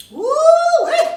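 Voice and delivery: a high, loud whoop of woo-wee; high loud woo-wee cry. Acted. Rabbit whoops to the farmer, high loud cry. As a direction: whoop it out like a lazy trickster. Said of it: "pulls that trick again".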